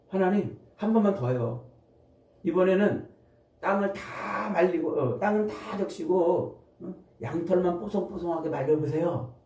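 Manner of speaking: high-pitched, coaxing plea; acted wheedling request. 기드온이 한 번 더 조름. (높은 음으로 조르듯 부탁하는 연기)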